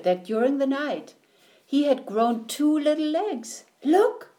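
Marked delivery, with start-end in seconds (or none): 3.8-4.3 high, loud triumphant cry. A narrator's reading. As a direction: crow it out proudly like a new frog. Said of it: "he said triumphantly".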